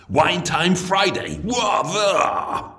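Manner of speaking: braying posh party shout; posh toff shout. Acted. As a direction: bray it like a drunk toff. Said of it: "posh toff party cry".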